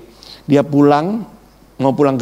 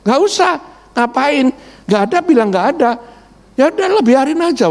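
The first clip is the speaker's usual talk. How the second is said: much higher, fast brush-off; wife dismissing husband's plan, high and brisk; dismissive wife; 10 semitones higher than usual. Retold or imitated. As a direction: wave it away like she's done with it.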